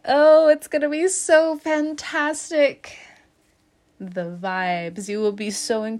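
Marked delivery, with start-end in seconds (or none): 0.1-3.0 high, loud, slow gushing. Acted. Reader gushing to Libra about the future. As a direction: swoon over how good it'll be.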